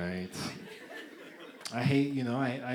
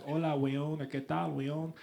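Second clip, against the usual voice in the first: Chilean Spanish; 3 dB quieter, slower than usual. Retold or imitated. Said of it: "They go like".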